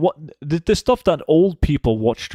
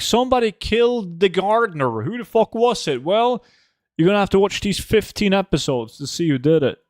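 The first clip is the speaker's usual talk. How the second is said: higher, hammed-up TV mystery teaser; mock crime show narrator; 7 semitones higher than usual. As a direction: tease it like a cheesy British whodunit trailer. Parodying a British crime show.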